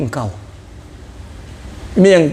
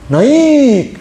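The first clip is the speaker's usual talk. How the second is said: naik said with a high-pitched rising peak, louder; high intonation; 7 semitones higher, 4 dB louder than usual. Shown as a demonstration. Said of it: "untuk menunjukkan intonasi tinggi".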